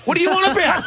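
high, indignant mock outrage. Real, not acted. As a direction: play up being offended.

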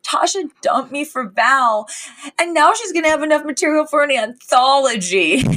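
high-pitched mock wail; whiny lament. Fran complaining she was dumped for Val, whiny lament.

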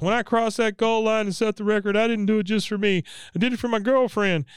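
high Ricky voice. Retold or imitated. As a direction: brag like a teen star. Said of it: "he goes".

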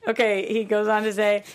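ordinary delivery

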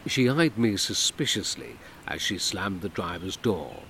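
usual speaking voice

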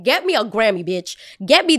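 hyped joke. Real, not acted. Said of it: "I got ahead of myself".

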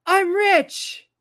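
high, loud burst of joy; Herman's delight. Retold or imitated. Herman staring at the check, loud gleeful cry. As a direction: blurt it out like a windfall. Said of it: "Herman looks at the check and goes".

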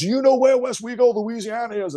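put-on heavy Bronx accent. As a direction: shout it in a thick Bronx accent. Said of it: "with that heavy Bronx accent".

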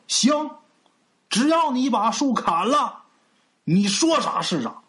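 high-pitched emphatic agreement, slow in the middle; displeased, table-slapping. A narrator's reading. Village chief, laughed at by the crowd, takes the dare, riled. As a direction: agree hotly, as if stung. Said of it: "不乐意了，啪又是一拍桌子".

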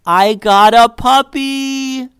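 very high, loud, drawn-out announcement; thrilled squeal. A narrator's reading. Jessica revealing her new puppy to Liddy, thrilled squeal. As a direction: burst with the news, stretching every word.

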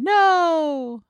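high, drawn-out mock "no"; off-camera woman. Co-host imagines someone off camera, high mock wail. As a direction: mimic the ghostly cry, pitched far up. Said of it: "Somebody's standing off camera going".

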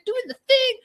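high hyped-up cameo voice; super animated. Retold or imitated. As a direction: overdo the peppy cameo host. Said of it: "See how I'm, like, super animated?".

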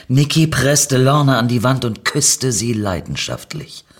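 ordinary delivery, nothing marked

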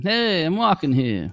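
mock New York tough-guy accent. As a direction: drawl it like a Brooklyn cabbie.

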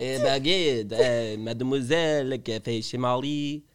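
slow, put-on French accent; mock French accent. Showing friends his joke French accent, slow and hammy. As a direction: ham up a French accent, drawn out. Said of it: "Wait for your French accent".

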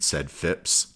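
ordinary delivery, nothing marked

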